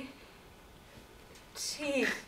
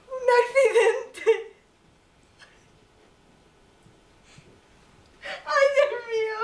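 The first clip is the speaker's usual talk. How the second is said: high, slow, sobbing disbelief; crying shock; 10 semitones higher, slower than usual. Acted. Mother hearing on the phone that her son had an accident, sobbing. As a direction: break down at the news.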